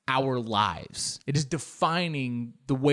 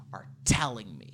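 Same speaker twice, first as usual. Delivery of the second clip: hushed mock intensity; 4 semitones lower, 4 dB quieter than usual. Mock mystical yogi belief.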